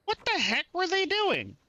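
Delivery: high-pitched, incredulous exclaiming; alarmed disbelief. Real, not acted. Gamer watching packmates attack a huge dinosaur, alarmed disbelief. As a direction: cry out in appalled disbelief.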